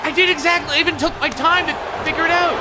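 high-pitched frustrated complaint; aggrieved, worked-up insistence; exasperated protest. Real, not acted. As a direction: protest high, insisting you followed instructions. Protesting the failed button prompt.

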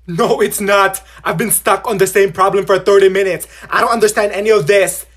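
loud, exasperated complaint; frustrated shout. Acted. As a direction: vent the frustration loudly. Frustrated student in quiz.

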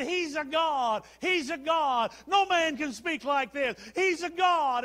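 high, loud, slow shouted chant; drawn-out mock-worship cheer; crowd acclamation. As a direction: holler it like an adoring crowd. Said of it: "and they said".

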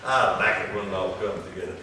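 low, soft, amused aside; chuckling. Real, not acted. Preacher enjoying how scripture fits together, low chuckling aside. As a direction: say it softly through a chuckle. Preacher laughing at his point.

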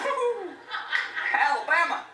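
very high, whooping Southern yee-haw; mock Southern hollering. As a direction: holler like a rowdy Southern church group. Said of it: "they had weird accents".

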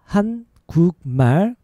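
three drawn-out, separate syllables; slow demonstration. Shown as a demonstration. Teacher showing learners the word for Korean, slow. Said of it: "Faster is".

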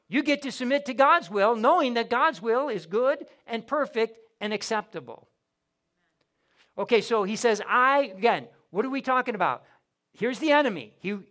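ordinary delivery

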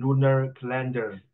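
"lunar calendar" with R-coloured endings; imitated R pronunciation. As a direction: copy the student's R in "lunar" and "calendar". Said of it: "the way you pronounce the R".

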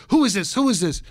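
mock angry demand. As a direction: demand it like a fed-up mother grabbing the phone. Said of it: "and I finally got angry".

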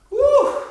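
high, loud woo cheer; high whoop. Real, not acted. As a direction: let out a happy woo. Coach cheering after set.

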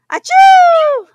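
big loud sneeze, high pitched; huge sneeze. Acted. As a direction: explode into a giant sneeze.

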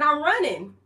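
excited retort. Retold or imitated. Retelling running from guy.

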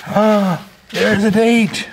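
high, surprised find; excited discovery. Real, not acted. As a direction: gasp out the discovery. Discovers a date on the sign.

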